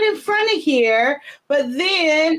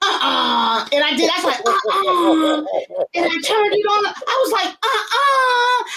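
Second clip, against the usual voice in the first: stunned disbelief; 4 semitones higher than usual. Retold or imitated. Gasping disbelief camera works.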